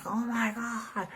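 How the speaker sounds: high, slow breathy oh my God; amazed gasp. Retold or imitated. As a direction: mimic her stunned delight. Friend reacting in amazement.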